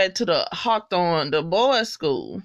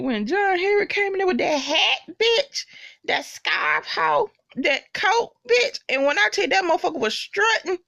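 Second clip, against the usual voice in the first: much higher, giddy and laughing; gushing excitement; 11 semitones higher than usual. Real, not acted. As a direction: rave about him like you can't contain it. Gushing over a character's entrance.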